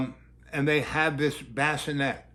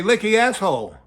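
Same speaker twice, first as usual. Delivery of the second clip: loud, higher teasing echo; teasing repeat; 6 semitones higher, 7 dB louder than usual. Real, not acted. Comedian teasing the child about her phrase, loud. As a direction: toss the phrase back playfully. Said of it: "What did you say?".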